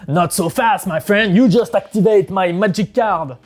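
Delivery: a lightly acted, gloating anime duelist in English; smug duelist taunt. Player taunting his opponent with a trap, in mock gloating English.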